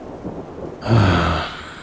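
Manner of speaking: long, weary exhaled sigh; bored sigh. Acted. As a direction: sigh with tired impatience. Spirit bored watching the struggle.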